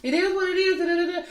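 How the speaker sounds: high, airy brush-off voice; dismissive brush-off. Retold or imitated. Friend quoting Monique shrugging off Candace, high dismissive voice. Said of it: "trying to brush you off".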